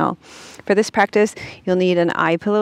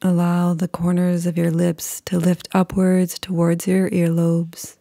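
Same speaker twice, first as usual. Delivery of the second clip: calm, unhurried meditation voice with long pauses; guided meditation. Acted.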